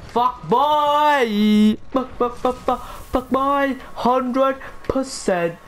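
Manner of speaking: high, stuttered mocking yell; taunting shout. Gamer taunting his opponent, taunting shout.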